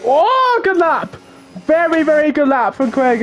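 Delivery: high, thrilled burst over a great lap; real delight as the lap lands; excited cheer. Real, not acted. Reacting live to a great lap.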